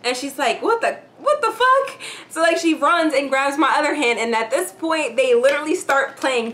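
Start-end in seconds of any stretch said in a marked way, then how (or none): 0.6-2.0 much higher, stammered disbelief; shocked. Retold or imitated. Friend turning around to see her pulled away, shrill shocked stammer. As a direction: stumble over it, stunned. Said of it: "she turns around, like, shocked".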